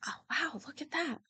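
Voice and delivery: soft, high "oh wow"; teen hiding her shock at the scene; hushed awe. Retold or imitated. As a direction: mutter it under your breath in disbelief. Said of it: "I was like".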